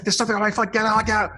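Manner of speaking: high, urgent panicked plea; panicked cry. Retold or imitated. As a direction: beg in a frightened rush. Driver panicking in car.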